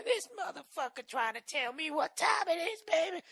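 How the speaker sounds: high, shrill-free falsetto street woman cursing; loud cleaning lady impression. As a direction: belt it out as a loud housekeeper. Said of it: "So she's out there".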